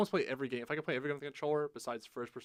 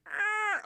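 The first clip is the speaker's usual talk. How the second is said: high-pitched imitated wraith screech; thin high held shriek, mock creepy; creepy scream; 5 dB louder than usual. Describing the Nazgul from the movies, creepy scream. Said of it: "the really creepy scream".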